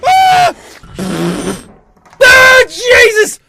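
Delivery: loud high panicked shouts; scared horror game streamer. Real, not acted. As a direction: scream at them in terror. Monsters approaching.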